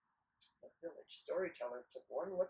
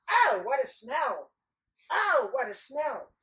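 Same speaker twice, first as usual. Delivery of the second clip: loud, high, drawn-out cry at a stink; big hammed-up revulsion; exclaimed disgust; 6 semitones higher, 11 dB louder, slower than usual. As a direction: exclaim slowly and loudly at a stench. Passers-by model disgusted exclamation.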